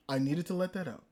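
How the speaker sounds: low, soft repeat to oneself; quiet wind-down. Real, not acted. Fan coming down after his rant, quiet. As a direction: drop the voice and settle.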